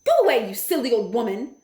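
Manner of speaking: scornful society lady; Missus Jenkins. A narrator's reading. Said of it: "said Missus Jenkins".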